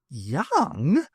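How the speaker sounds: surprised rising squeak; startled, pitch flying up; high-pitched exclamation. A narrator's reading. Bernice blurts out in high surprise. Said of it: "her high-pitched exclamation".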